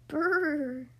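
a shivering brrr through the lips; cold shiver brrr. Acted. Lake frozen in winter, shivering cold brrr. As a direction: shiver out a brrr like you're freezing.

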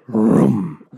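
loud, low imitated car engine; engine vroom. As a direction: rev it like a race car. Imitates engine after racer's name.

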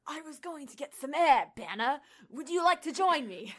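loud, high, hammed-up Australian accent; a bad Australian Thor voice, laughing at the end; mock Australian accent. Friend voicing Thor in an Australian accent for the group. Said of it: "I wasn't gonna do an Australian accent".

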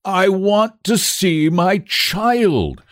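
very slow, high-pitched insistence; near shriek. A narrator's reading. Father on verge of collapse, almost shrieking. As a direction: draw out every word, almost shrieking. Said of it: "Mister Button almost shrieked".

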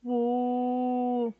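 long drawn-out boo from the dark; a big hollow boo to scare him; the elephant in the dark garden, long boo; booming strange voice. A narrator's reading. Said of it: "un extraño vozarrón que decía".

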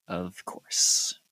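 low, breath-heavy reply; sighed reply. Acted. Kneeling woman answering the investigator, sighing. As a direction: say it through a small sigh. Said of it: "She kinda sighs and says".

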